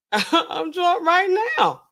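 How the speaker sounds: drunk imitation. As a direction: stretch it out like a drunk man bragging. They say they're drunk on air.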